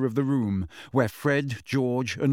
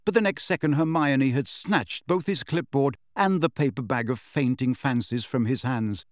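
ordinary delivery, nothing marked

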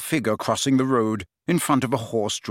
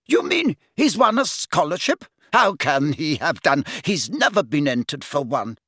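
higher-pitched incredulous matron; disbelieving matron; 4 semitones higher than usual. A narrator's reading. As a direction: question it in disbelief, starting high. Matron doubts the visitor's story.

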